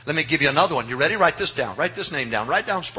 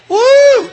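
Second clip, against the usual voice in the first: loud, high preacher's whoop; 12 dB louder than usual. Real, not acted. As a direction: let out a joyful whoop. Preacher whoops mid-sermon.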